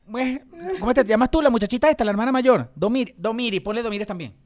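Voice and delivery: high, fast mock mother. Voicing the mother naming the baby. (imitando a la mamá con voz aguda)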